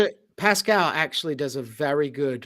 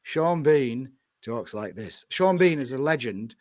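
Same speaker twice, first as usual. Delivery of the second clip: Sean Bean-style Yorkshire voice; Yorkshire accent; 3 semitones lower than usual. Acted. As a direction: talk like a Yorkshireman from Sheffield. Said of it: "I can only do the Yorkshire accent".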